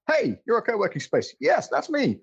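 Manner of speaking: high squeaky two-voice skit; mock exchange. Man acting out the mayor's office greeting a co-working space, high and mock. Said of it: "So in a kind of like".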